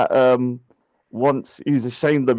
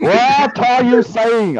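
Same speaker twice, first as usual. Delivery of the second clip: slow, stretched, higher whiny protest; drawn-out mock whine; 8 semitones higher, much slower than usual. Mocking his friend's claim, he drags out a whiny protest. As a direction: drag every word out like a sulky complainer. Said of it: "you're either accusing someone or you're whining".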